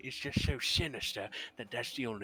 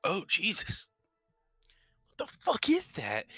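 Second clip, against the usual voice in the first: shocked outburst; 9 semitones higher, 6 dB louder than usual. Acted. Character disgusted by stench.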